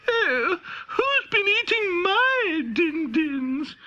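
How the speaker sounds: a mummy dinosaur near tears over her empty bowl; tearful mummy dinosaur. A narrator's reading. Mummy dinosaur wails about her eaten din-dins, high and tearful. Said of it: "And the mummy dinosaur said".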